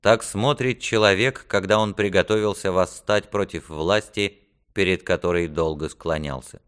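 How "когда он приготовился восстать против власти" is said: ordinary delivery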